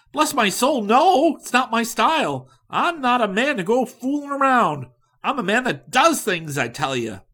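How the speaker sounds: high, loud, scornful no; indignant go-getter. A narrator's reading. Likens refusing Riley's delay, loud and indignant. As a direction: refuse to waste a day.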